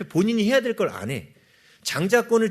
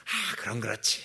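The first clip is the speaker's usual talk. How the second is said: lower, softer contented aside; mock a gratified believer's satisfied sigh; pleased relief; 6 semitones lower, 7 dB quieter than usual. Believer pleased after receiving, mock satisfied relief. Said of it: "그러면서 좋아하고".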